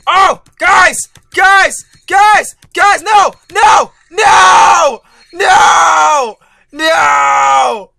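high loud cries of no; despairing shout. Real, not acted. Gamer shouting to viewers as he fails. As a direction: yell as the win slips away. Just misses finishing level.

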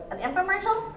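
high-pitched mock young person's question; puzzled twenty-two-year-old. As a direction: imitate a baffled youngster, voice rising sharply. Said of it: "talking to my twenty-two-year-olds like".

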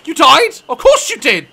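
high, loud disbelief; shocked. Real, not acted. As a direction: react in disbelief. Shocked reaction.

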